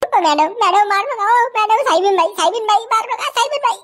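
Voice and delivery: high, wailing plea to madam; pleading cry. Acted. Wife begging the policewoman not to hit her, high wail. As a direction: beg her to stop the beating.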